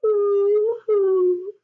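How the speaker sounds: high loud fake boo-hoo sob; mock crying. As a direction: wail a cartoon boo-hoo.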